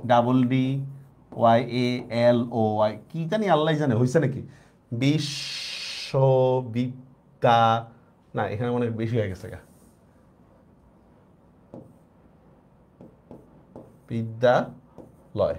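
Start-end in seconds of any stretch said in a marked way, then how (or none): 0.0-3.0 letters of বিশ্ববিদ্যালয় spelled slowly, unsure; slow letter spelling. Shown as a demonstration. Teacher slowly spelling a long word in English letters. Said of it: "কিভাবে লিখবো". 4.9-15.5 muttered, drawn out while writing; slow sounding out. Shown as a demonstration. Teacher quietly sounding out বিশ্ববিদ্যালয় while writing it. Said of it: "sounding out the word while writing".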